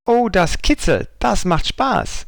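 high, slow delighted call; exclaiming. A narrator's reading. Young elephant blowing bubbles, calling out happily. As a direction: draw out the fun with a happy cry. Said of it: "rief sie".

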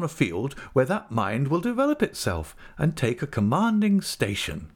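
high-pitched, grand wifely opinion; Mrs Micawber, proud. A narrator's reading. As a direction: hold forth proudly on your husband's talents.

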